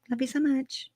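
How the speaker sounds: mocking echo. Repeats Lori's words mockingly higher.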